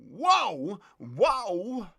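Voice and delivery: startled double whoa; surprised whoa. Real, not acted. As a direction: caught off guard, react out loud. Sudden reaction on stream.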